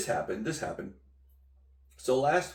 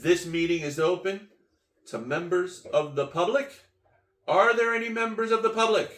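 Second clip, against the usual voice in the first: raised, projected meeting-chair voice; official announcer; 5 semitones higher, 6 dB louder than usual. Acted. Board asking the room for public members, projected official announcement. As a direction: call it out formally, climbing higher and louder. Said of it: "And they said".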